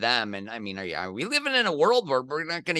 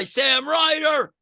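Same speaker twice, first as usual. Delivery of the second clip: cheering shout; 11 semitones higher, 3 dB louder than usual. Real, not acted. Fan cheering his golfer's clutch putt, loud high shout. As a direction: yell it like a fan at the green.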